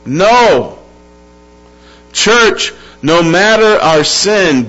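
emphatic sermon, key words drawn out; impassioned preaching. Real, not acted. Preacher asking the church what God will do, impassioned preaching. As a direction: drive each question and answer home.

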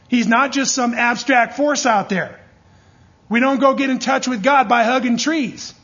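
forceful pulpit declaration; preaching at full force. Real, not acted. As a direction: preach it out loud to the whole room. Preacher rebuking pantheism.

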